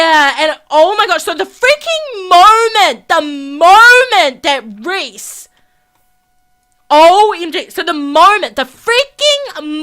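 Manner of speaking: loud breathless gossip; excited. Real, not acted. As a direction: blurt it out in excitement. Excited retelling.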